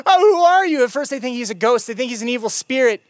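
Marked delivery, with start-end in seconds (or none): 0.1-0.8 frightened shout. Retold or imitated. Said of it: "they shout out".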